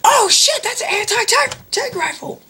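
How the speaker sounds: very high screeched alarm; mock panicked freak-out. Guard seeing the rifle in a retold parody, mock panicked screech. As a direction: flip out in exaggerated panic. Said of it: "Really just flips out".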